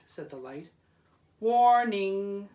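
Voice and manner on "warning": very loud, ringing warning; bell's warning. Acted. Iron bell warning the boats, very loud call. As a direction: clang it out like a bell. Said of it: "said the bell".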